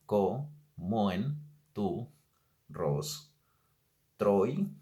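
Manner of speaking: "Co-, mo-, en, tu, ros-, troi" counted out with pauses; teacher scanning "Co-, mo-, en, tu, ros-, troi" slowly; syllable by syllable. Shown as a demonstration. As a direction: tap out each syllable of the line.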